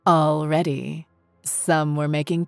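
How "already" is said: ordinary delivery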